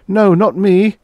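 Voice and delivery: a high, loud, strangled plea; a loud, high refusal, choked with terror; choked protest. A narrator's reading. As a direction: beg through a tightening throat. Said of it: "his breath strangling in his throat".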